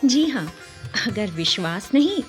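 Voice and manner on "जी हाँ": usual speaking voice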